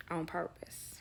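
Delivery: a sly whisper, slipped in quietly; whispered aside. Real, not acted. Telling friends she was late on purpose, whispered. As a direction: confess it under your breath.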